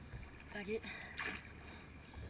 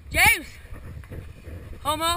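calling out; 6 semitones higher, 5 dB louder than usual. Real, not acted. Calling out to friend.

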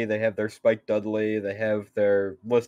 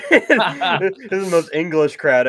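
laughing; 6 semitones higher, 3 dB louder, slower than usual. Real, not acted. Laughing about British crowd.

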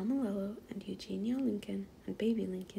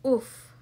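loud, sudden storybook oof; impact grunt; 2 semitones higher, 12 dB louder than usual. A narrator's reading. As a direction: voice a hard landing. Pig lands after flying.

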